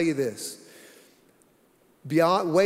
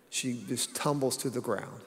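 ordinary talk, nothing marked

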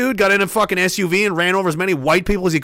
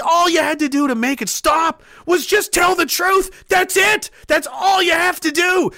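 slow, high, shouted hatred; furious shout; 8 semitones higher, slower than usual. Real, not acted. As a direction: scream each word at them slowly. Furious rant at the liars.

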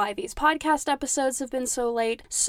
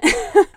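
burst of laughter; 10 semitones higher, 10 dB louder than usual. Real, not acted. Laughing at own imagined quote.

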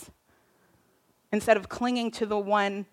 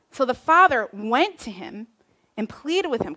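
ordinary talk

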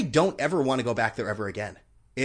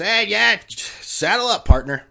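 higher, louder hammed-up cowboy host; a mock Western barkeep welcoming a rider; cowboy bartender; 8 semitones higher, 9 dB louder, slower than usual. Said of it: "He didn't say that".

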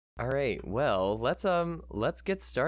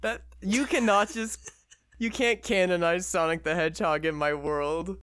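mock outrage; 6 semitones higher than usual. Real, not acted.

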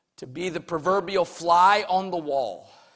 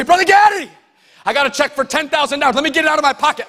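higher and louder, fast and keen; eager, serious offer; 8 semitones higher, 8 dB louder, much faster than usual. Shown as a demonstration. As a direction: call out like you truly mean to hand over the check. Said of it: "your tone inflects your seriousness".

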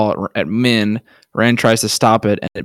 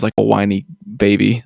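normal talking voice

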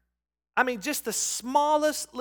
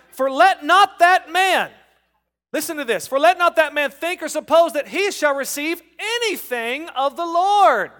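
emphatic scripture reading; 6 semitones higher, 7 dB louder than usual. Acted. Preacher reading James to the congregation, loud and emphatic. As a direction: proclaim the verse, leaning on not.

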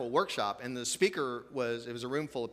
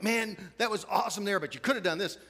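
higher, louder pumped-up critique; excited coaching parent; 8 semitones higher, 5 dB louder than usual. Retold or imitated. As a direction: coach your kid from the front seat. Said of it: "and then you're like".

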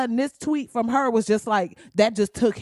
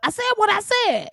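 defiant quote; 11 semitones higher, 4 dB louder than usual. Retold or imitated. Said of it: "that moment of".

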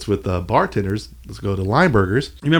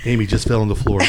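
ordinary delivery

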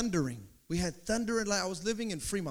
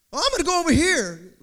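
higher, louder, quick jittery line; nervous mock fretting; 6 semitones higher, 8 dB louder, faster than usual. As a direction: rush it like a spooked person. Scared listener acting on rumor.

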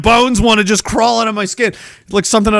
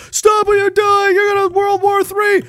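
panicked official; 14 semitones higher than usual. Retold or imitated. Officials in the room pleading with the president, high mock panic. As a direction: beg them to stop like it's the end of the world. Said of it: "they're in the rooms like".

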